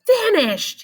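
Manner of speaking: high, bright proud exclaim; proud kid exclaiming his word. A narrator's reading. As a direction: announce it proudly. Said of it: "he exclaimed".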